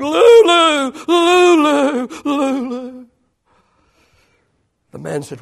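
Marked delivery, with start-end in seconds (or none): 0.0-3.1 high wail, falling and fading; hammed-up tearful calling; mock weeping holler. Preacher acting a jilted man on his bed, mock weeping. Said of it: "crying and weeping and screaming and hollering".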